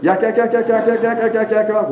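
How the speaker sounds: high-pitched imitation of endless gossip; mock gossiping ladies. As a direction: mimic women gabbing on and on, pitched high. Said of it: "I heard about ninety-eight percent of yak".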